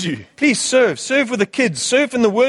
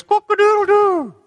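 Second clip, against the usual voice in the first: rooster crow; 13 semitones higher, 3 dB louder than usual. Retold or imitated. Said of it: "It goes".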